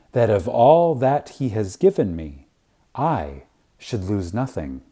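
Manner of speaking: usual speaking voice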